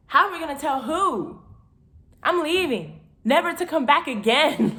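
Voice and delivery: high, loud indignant reply; panicked retort. Retold or imitated. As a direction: fire it back in alarm.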